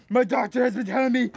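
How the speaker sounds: normal talking voice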